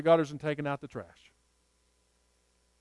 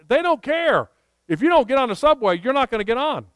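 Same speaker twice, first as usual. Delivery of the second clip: higher, louder insistence, pressing the point hard; emphatic retold scolding; 7 semitones higher, 6 dB louder than usual. Friend urging the speaker onto the crowded subway, emphatic and higher. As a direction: hammer home the subway rule.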